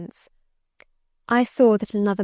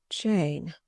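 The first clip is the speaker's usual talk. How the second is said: low, soft and sorrowful; a quiet, bitterly sad first call of her name; bitter sadness; 4 semitones lower, 6 dB quieter than usual. Acted. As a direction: let the name sink in quiet grief. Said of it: "in such an accent of bitter sadness".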